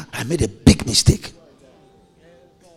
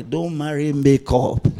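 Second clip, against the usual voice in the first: ordinary delivery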